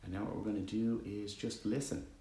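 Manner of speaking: calm, even meditation voice with long pauses; guided meditation. Acted. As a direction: guide listeners gently into stillness, leaving long silences.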